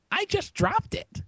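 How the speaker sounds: slow, very high mock robber; high squeaky voice. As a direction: squeal it slowly.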